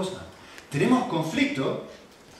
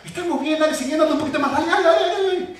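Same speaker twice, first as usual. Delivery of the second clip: higher and louder, laughing as he pushes on; eager urging; 9 semitones higher, 5 dB louder, faster than usual. Retold or imitated. Father urging his tired kids to keep riding, eager laughing push.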